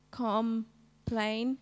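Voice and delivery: "complain" in two slow beats, com, then plain, with a gap; split into syllables. Shown as a demonstration. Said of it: "clap the syllables".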